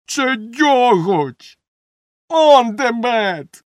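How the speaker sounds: high, loud, stretched-out yells; indignant shouting. A narrator's reading. Baker points out the helper's mistake, indignant shouting. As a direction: bellow each word slowly and high. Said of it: "закричав Бомштекс".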